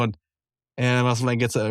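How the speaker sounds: ordinary delivery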